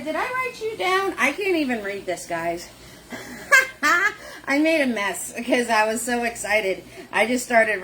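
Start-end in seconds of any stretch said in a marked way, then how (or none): 3.1-3.8 a high breathy giggle; embarrassed high giggle; breathy laugh. Real, not acted. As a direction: giggle at yourself. Laughs at own messy notes.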